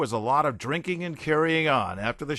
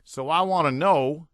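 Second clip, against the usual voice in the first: loud, higher stage yell; 5 semitones higher, 7 dB louder than usual. A narrator's reading. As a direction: yell it out to the crowd. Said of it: "yelled Derek".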